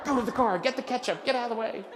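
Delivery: a mild mock of an impatient partner; exasperated partner. Partner scolds him at register.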